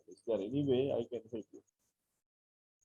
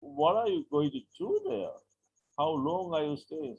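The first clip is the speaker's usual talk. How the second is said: high-pitched, slow eager questions; happy, excited; 4 semitones higher, 4 dB louder, slower than usual. Shown as a demonstration. Asking about a San Francisco trip, excited curiosity. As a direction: ask brightly with keen interest, pitch jumping up. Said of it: "he's happy and he's excited".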